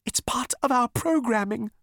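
very high, fearful explanation; terrified. A narrator's reading. Elevator explaining its programming, terrified. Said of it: "whispered the elevator in what sounded like terror".

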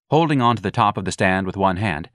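ordinary delivery

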